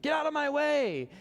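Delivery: high, loud mock street yell; New York yelling. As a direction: bark it like a New Yorker stuck in traffic. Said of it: "We would just yell at each other".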